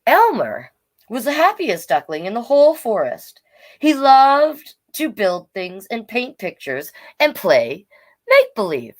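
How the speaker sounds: bright, lively storybook reading; reading to children. Acted. Storytime host reading Elmer's story to young viewers. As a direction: read it out to a room of kids.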